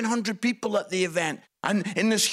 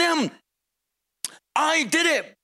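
ordinary delivery

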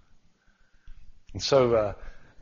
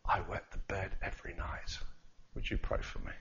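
soft, near-whispered confession; very quietly; 6 semitones lower, 12 dB quieter than usual. Retold or imitated. Student at the end confessing to the preacher, whispered. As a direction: hush it like a shy child asking. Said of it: "she said, very quietly".

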